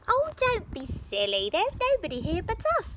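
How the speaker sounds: quick, high telling-off; scolding giantess. Acted. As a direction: scold a silly husband. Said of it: "the giantess scolded".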